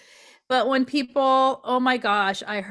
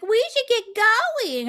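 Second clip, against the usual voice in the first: sing-song hint; 12 semitones higher, slower than usual. Retold or imitated. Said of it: "she's like".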